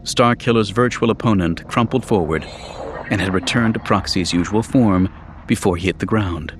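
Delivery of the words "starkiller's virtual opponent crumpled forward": usual speaking voice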